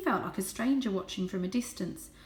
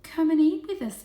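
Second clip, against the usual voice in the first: higher, louder coaxing voice; sweet cousin; 9 semitones higher, 8 dB louder than usual. A narrator's reading. Cousin Jasmine invites Varjak to eat, sweet and high. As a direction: coax him to the bowl. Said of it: "said Cousin Jasmine".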